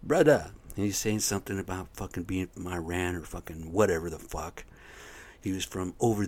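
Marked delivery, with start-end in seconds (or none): 0.0-0.5 higher, louder pleading call of brother; imitating a stranger. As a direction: hail me like a stranger at the roadside. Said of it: "He's like".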